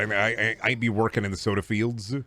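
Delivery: usual speaking voice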